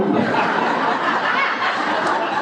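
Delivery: breathy high chuckle; laughing. Real, not acted. Laughs at his own joke.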